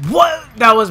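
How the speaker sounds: high, loud yelp of disbelief; loud, high gamer reaction; startled outburst. Real, not acted. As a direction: cry out as if caught off guard. Startled by own death in game.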